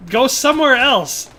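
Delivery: high, loud, frustrated yelling at lazy creators; pleading, drawn-out yell of frustration; exasperated shout. Retold or imitated. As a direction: shout it like you're fed up telling them again. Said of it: "I'm like".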